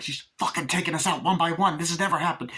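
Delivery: high-pitched mock outrage; panicked rant. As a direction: rant as if everything is going wrong. Acted rant of panicking character.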